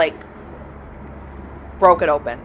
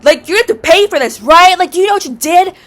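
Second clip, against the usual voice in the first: higher, louder scolding; landlord going off; 8 semitones higher, 4 dB louder than usual. Retold or imitated. Landlord scolding the tenant, higher loud scolding. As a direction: mimic her tearing into you. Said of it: "going off on me".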